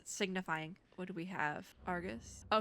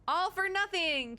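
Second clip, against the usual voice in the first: dramatic; 9 semitones higher, 4 dB louder, slower than usual. Acted.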